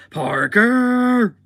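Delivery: long, loud, high-pitched yell; mock scream. Boss screaming for his employee Parker, mock full-lung yell. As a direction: holler the name, drawn out and high. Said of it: "screaming at the top of his lungs".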